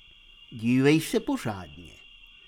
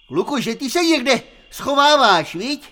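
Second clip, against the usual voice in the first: calling out; 10 semitones higher, 9 dB louder than usual. Acted. Calling to hiding Hurvínek.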